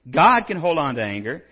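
wry self-mocking scholarly build-up; mock-pompous buildup. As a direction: puff it up, then deflate it. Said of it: "He says".

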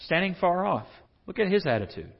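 normal talking voice

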